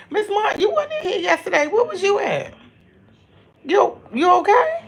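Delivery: greeting a viewer in a high playful voice. Real, not acted. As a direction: pitch way up like calling a friend.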